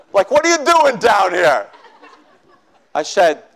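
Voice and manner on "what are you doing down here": rough. Retold or imitated. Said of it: "he's kinda rough with me".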